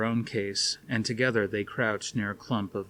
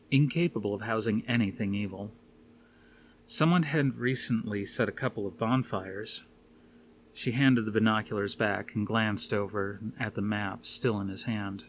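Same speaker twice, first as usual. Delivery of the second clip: plain reading voice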